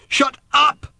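high, loud scream; furious shout. A narrator's reading. Random cutting off her mother, shouting furiously. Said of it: "shouted Random".

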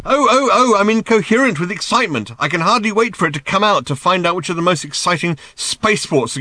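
high, loud mock excitement; sarcastic shouting. A narrator's reading. Arthur pretending excitement over Ford's guide, sarcastic shouting. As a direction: gush furiously with scathing sarcasm. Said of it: "shouted Arthur again".

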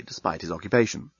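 usual speaking voice

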